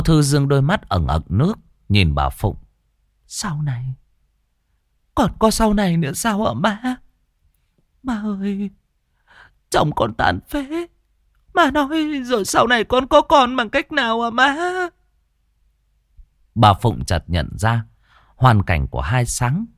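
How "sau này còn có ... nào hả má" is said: high, rising despairing questions; bitter anguished cry. Acted. Wife crying out that her husband is crippled, anguished. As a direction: throw the hope back at her in despair.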